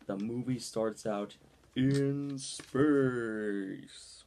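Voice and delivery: very slow, loud, booming; mock epic announcement. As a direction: drag it out like a giant movie trailer. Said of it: "I kinda had to do that for a joke".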